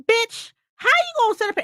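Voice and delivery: high, indignant call-out at the heroine; sassy callout. Retold or imitated. Reader scolding the book heroine, sassy. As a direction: call her out, incredulous.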